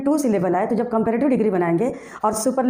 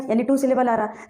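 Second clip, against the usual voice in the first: usual speaking voice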